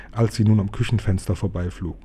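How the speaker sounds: usual speaking voice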